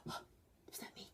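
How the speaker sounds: breathy shocked whisper. Retold or imitated. Girl whispering in class after waking up, whispered in shock. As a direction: whisper it in horror. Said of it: "I get up, and I'm like".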